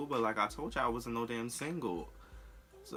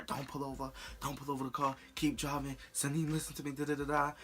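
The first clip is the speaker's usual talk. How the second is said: imitating him, pitched higher and pushy; mock yelling boyfriend; 7 semitones higher, faster than usual. Boyfriend ordering her to keep driving, in a mock yell. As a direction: retell his orders as a half-hearted yell. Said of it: "he kept yelling at me".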